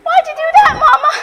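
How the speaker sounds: high, loud, wounded complaint; indignant protest. Acted. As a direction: protest at what mother just did. Hurt after being attacked.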